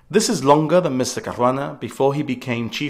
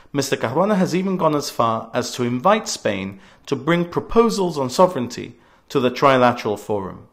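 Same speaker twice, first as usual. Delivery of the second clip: usual speaking voice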